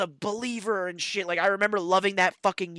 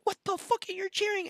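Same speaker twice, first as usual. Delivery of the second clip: incredulous; 14 semitones higher than usual. Retold or imitated. Exasperated reply to cheerers.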